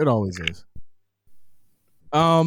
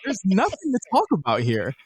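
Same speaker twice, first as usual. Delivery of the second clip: raised, high, incredulous; exasperated retold reply; 8 semitones higher than usual. Retelling his reply to a podcast group, high and scoffing. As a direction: scoff it like it's obvious.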